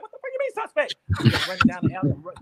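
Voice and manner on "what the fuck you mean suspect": very high, incredulous cry; shocked outrage. Retold or imitated. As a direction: squeal it in disbelief. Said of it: "I'm like".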